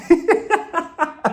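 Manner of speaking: high peal of laughter; laughing. Real, not acted. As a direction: burst out after the punchline. Laughs at jail joke.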